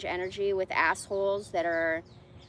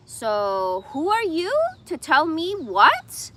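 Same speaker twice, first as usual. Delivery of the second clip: slow, loud and higher, challenging; retold confrontation; 7 semitones higher, 9 dB louder, much slower than usual. Confronting the lady at the checkout, slow and loud. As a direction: spell out each word at her, loud.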